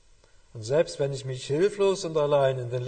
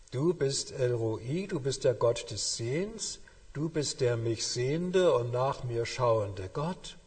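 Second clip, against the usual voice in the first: solemn reading; slower than usual. Shown as a demonstration.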